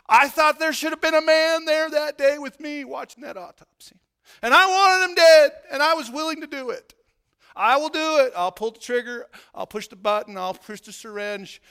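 loud, high, relentless preacher's roar; preaching at full force. Acted. Preacher at peak intensity.

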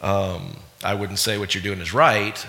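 ordinary talk, nothing marked

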